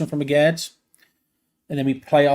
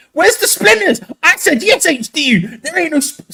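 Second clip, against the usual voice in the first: worked up; 11 semitones higher, 4 dB louder than usual. Real, not acted. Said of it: "I'm getting really worked up".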